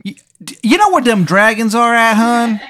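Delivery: high, loud, badly faked Maryland voice; terrible Maryland accent. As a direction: ham up a cartoonish Maryland accent. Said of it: "sounds like a bad impression of a Maryland accent".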